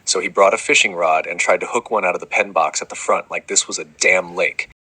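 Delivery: usual speaking voice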